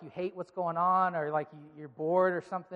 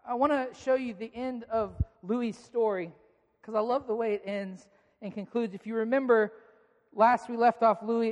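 normal talking voice

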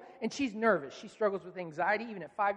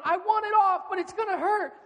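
put-on high, loud, distressed little-boy wail; whining child; 10 semitones higher, 6 dB louder than usual. Son answering dad's ultimatum, whining. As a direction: imitate a son wailing back. Son whines back at dad.

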